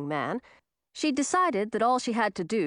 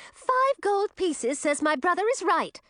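very high-pitched, gleeful squeal; high excited character voice; 12 semitones higher than usual. A narrator's reading. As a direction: squeal it in delight. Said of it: "clapped her hands and squealed".

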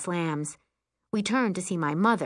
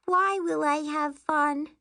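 put-on high small-child question; high-pitched childish doubt; doubtful little girl; 10 semitones higher than usual. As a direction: ask like an unconvinced little kid. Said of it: "then asks".

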